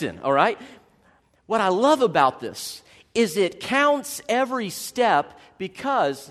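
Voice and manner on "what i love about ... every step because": usual speaking voice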